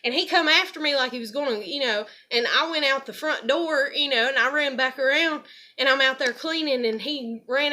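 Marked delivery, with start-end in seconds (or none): none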